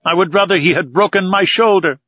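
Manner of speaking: a loyal servant who means every word; earnest servant. A narrator's reading. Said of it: "Conseil was in earnest".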